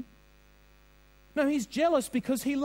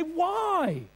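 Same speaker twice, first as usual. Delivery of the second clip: pleading husband; 6 semitones higher than usual. Pretend. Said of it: "he'd plead with his wife and say".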